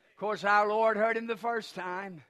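ordinary delivery

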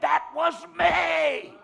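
high, loud, shouted declaration; booming preacher's cry; thundering proclamation. Acted. Preaching at full force.

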